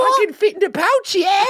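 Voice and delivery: very high squeaky Aussie; mock Australian accent. Co-host joining the impression of the listener, squeaky mock Australian. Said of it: "that's what this guy sounds like".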